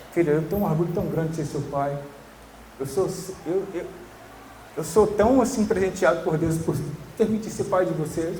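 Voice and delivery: gentle fatherly pride; tender father. Retold or imitated. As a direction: say it to your son with quiet delight. Speaker quoting words to his kids.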